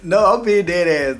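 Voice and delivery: laughing. Real, not acted. After laugh.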